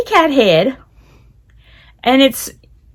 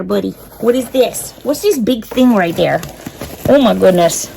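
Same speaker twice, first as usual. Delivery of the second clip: usual speaking voice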